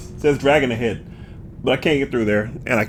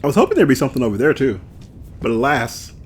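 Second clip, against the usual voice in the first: usual speaking voice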